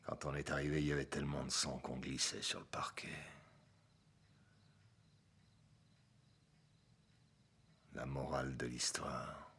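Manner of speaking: low, soft voice with heavy pauses; subdued, bitter confession. Acted. Ex-cop confesses his mistake to Walter, subdued and grave. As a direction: weigh each phrase quietly, like a hard lesson. Confesses the fatal half-measure.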